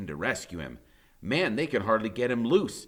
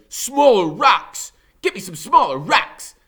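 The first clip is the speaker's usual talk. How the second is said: bully's command; 11 semitones higher, 11 dB louder than usual. Acted. Blade barking orders at gang.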